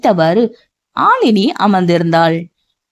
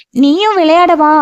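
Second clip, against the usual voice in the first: put-on childlike voice; 8 semitones higher than usual. A narrator's reading. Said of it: "குழந்தையை போல அடம்பிடிக்க".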